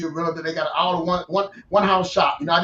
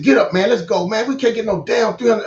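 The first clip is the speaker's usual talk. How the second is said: louder, a touch higher, fired-up retelling; retold loud outburst; 5 semitones higher, 5 dB louder than usual. Telling his wife to get up and leave, mock-loud. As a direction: rouse her like you're calling it quits.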